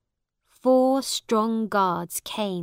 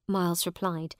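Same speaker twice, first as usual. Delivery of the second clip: ordinary delivery, nothing marked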